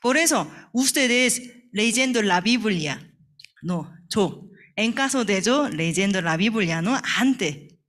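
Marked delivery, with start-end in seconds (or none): none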